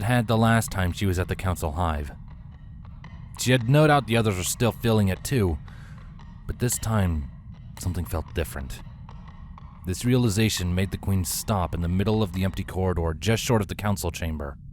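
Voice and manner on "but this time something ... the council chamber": plain reading voice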